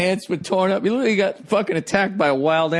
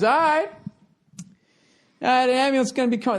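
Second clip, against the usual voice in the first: higher, fast offhand cop; retold cop; 8 semitones higher, faster than usual. Imitating the cop brushing off the injured rider. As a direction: rattle it off like a bored cop. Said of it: "And he goes".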